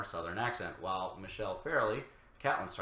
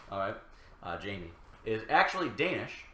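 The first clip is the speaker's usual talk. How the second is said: ordinary delivery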